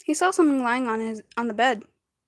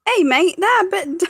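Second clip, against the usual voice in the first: high, loud, slow British voice; drawn-out put-on British accent; mock British accent; 7 semitones higher, 5 dB louder than usual. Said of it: "I'm being British right now".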